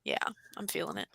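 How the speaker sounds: whispered, worn out from an early start; tired whisper. Real, not acted. Tired streamer whispering to chat she's feeling it. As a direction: breathe it out, drained.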